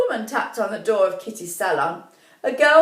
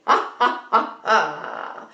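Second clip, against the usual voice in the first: wicked witch laugh; evil cackle; slower than usual. A narrator's reading. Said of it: "cackled the old woman".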